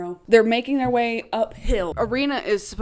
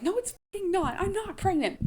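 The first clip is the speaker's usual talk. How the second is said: indignant; 10 semitones higher, 4 dB quieter than usual. Retold or imitated. Katniss wanting to retort to Finnick.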